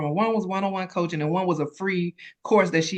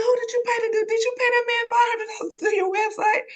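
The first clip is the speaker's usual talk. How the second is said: astonished disbelief. Retold or imitated. Business bestie shouting in shock at the website. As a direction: act out a stunned best friend's outburst. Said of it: "she said".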